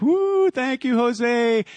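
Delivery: high, excited whoop of thanks; cheering. Retold or imitated. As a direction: whoop it up for the TV cameras. Cheering for the cameras.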